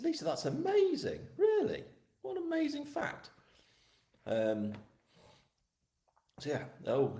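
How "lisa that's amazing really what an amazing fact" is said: very high-pitched astonishment; amazed. Real, not acted. Host reacts to Lisa's wartime family story, amazed. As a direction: react in a squeaky-high voice of wonder.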